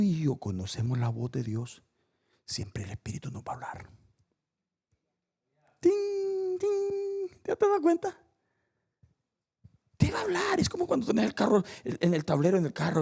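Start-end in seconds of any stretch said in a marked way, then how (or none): 5.8-7.4 high, ringing ting noises; long high-pitched chime imitation; imitated warning chime. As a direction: sing out a dashboard alert ding.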